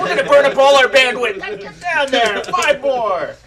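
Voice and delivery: loud, high barking drill instructor; drill sergeant. Pretend. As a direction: yell it down at the recruit. Standing over him, drill sergeant.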